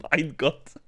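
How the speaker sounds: put-on chuckling through a German accent; laughing German accent. Echoing the German quote, laughing.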